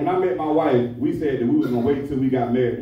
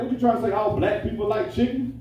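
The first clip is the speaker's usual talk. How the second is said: mock outrage; 8 semitones higher than usual. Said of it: "I said".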